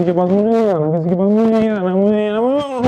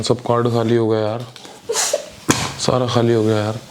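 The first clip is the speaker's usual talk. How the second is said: whiny stoned act; 8 semitones lower than usual. Acted. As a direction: play the dazed boyfriend pushing her away. Boyfriend acting high, whining.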